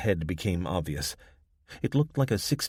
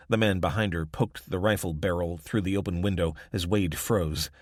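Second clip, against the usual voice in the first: usual speaking voice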